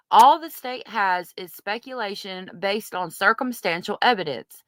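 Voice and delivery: ordinary delivery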